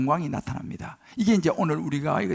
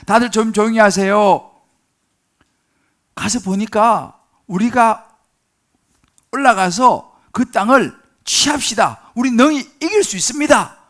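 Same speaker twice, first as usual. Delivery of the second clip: loud exhortation; 3 semitones higher, 7 dB louder than usual. Acted. Caleb quiets crowd, urges attack.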